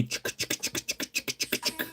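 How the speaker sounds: quick, voiceless chick-chick rattling; imitated pellet rattle. Shown as a demonstration. Showing viewers how the lure will rattle, imitated rattle noise. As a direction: mimic shotgun pellets shaking in a lure. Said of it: "I'm gonna throw a couple of shotgun pellets inside".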